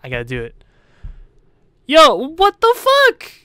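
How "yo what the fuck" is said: sudden high, loud, drawn-out yell; startled shout. Real, not acted. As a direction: jump out of your skin and yell it slowly. Startled by the game.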